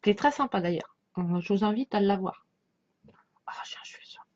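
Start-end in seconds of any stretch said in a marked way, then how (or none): 3.5-4.2 breathy whispered aside; whispering. Real, not acted. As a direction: drop to a whisper under your breath. Whispered aside about own lisp.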